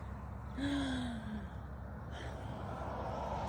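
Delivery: long, soft, drawn-out yawn; slow open-mouthed yawn; big yawn. Shown as a demonstration. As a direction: yawn deeply to get baby to copy. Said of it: "Let's see if I can yawn".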